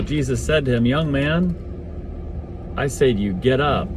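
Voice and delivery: usual speaking voice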